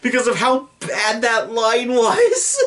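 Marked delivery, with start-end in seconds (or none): 1.9-2.7 laughing. Real, not acted. Laughing at bad line memory.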